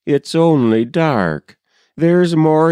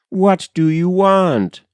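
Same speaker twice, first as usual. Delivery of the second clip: raised, higher call into the night; mild cry into the dark; 7 semitones higher than usual. Acted. A frightened wife calls out into the dark road. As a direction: call out toward the unseen stranger. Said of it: "she cried to all the dark".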